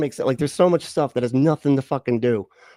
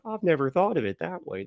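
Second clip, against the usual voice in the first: mock awe; 7 semitones higher, 5 dB quieter than usual. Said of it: "almost like a fucking Saturday Night Live bit".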